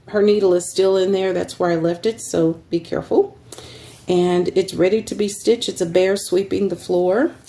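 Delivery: usual speaking voice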